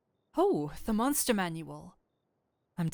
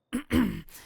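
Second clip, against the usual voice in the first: an embarrassed throat clear; an awkward, composing cough; 4 semitones higher, 5 dB louder than usual. Acted. Regains composure.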